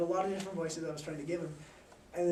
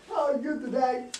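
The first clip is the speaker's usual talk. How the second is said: put-on teenage boy voice; 6 semitones higher, 5 dB louder than usual. Shown as a demonstration. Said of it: "a teenage boy talking".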